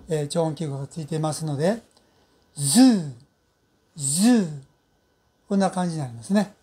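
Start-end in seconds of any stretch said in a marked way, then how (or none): 2.6-4.6 ズー with buzzed z and long rounded vowel; lips pushed forward. Shown as a demonstration. Teacher shows learners the word for zoo, ズー lengthened with protruded lips. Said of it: "口を前に大きく伸ばす音でしたね".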